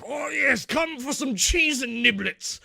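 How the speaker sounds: high, drawn-out, hammed-up aristocrat; posh party host. [name] welcoming cocktail party guests, hammed-up posh host. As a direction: sing it out like a pompous host. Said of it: "[name]'s throwing it".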